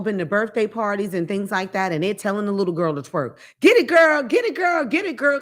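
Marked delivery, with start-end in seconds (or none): 3.6-5.4 much higher, loud party cheering; cheering crowd. Retold or imitated. Imitating adults at a birthday cheering a twerking girl. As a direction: hype her up like at a party.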